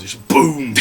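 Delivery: loud imitated movie punch noise boom; punch sound effect. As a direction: punch out boom like a film hit. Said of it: "that punching noise you get in films".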